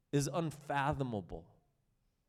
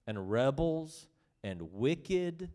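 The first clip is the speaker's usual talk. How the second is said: ordinary talk, nothing marked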